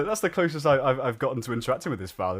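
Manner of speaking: normal talking voice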